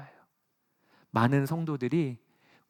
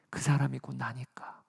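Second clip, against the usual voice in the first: whispered thought; 3 semitones lower, 6 dB quieter than usual. Pretend. Inner reflection spoken.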